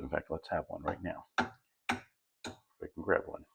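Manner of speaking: breathy whisper while reaching. Real, not acted. Reaching for a sprout to taste.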